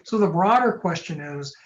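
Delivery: normal talking voice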